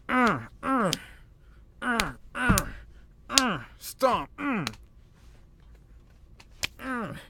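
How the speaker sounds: high, whimpering grunts of a beaten man; mock pained groans. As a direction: groan like he's being stomped.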